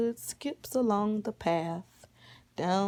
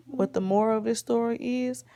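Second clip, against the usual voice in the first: ordinary delivery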